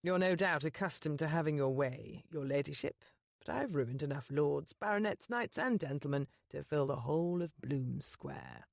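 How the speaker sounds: the Duke's voice. Acted. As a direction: speak down to her as a proud duke. Duke's dialogue read low.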